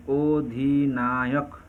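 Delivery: very slow, each syllable drawn apart. Shown as a demonstration. Teacher counting syllables for students, very slow. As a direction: spell out অধিনায়ক slowly, beat by beat. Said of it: "কয়টি দল আছে?".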